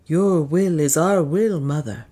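bow your voice to her; humble. A narrator's reading. Priest humbly yielding to Juana, submissive. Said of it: "said the priest humbly".

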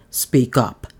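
curt, impatient demand. A narrator's reading. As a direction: snap it out impatiently. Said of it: "said Johnstone impatiently".

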